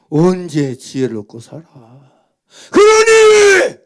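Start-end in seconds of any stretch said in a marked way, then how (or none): 2.7-3.8 long, high, loud plea; high drawn-out preacher's cry. Real, not acted. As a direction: stretch it out like a cry to heaven.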